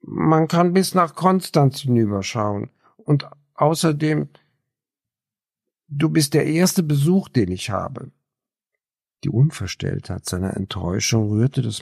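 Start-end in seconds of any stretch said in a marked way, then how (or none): 0.0-8.1 higher, hopeful voice of Raphael; lonely man begging his first visitor to stay; pleading young loner. A narrator's reading. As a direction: plead gently to make her stay.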